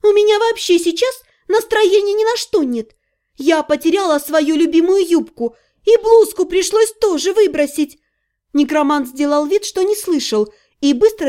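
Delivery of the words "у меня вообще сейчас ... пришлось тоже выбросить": high-pitched, put-out lament; complaining heroine. Acted. Heroine refusing the men's teasing, high grumbling. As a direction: moan about losses in a raised voice.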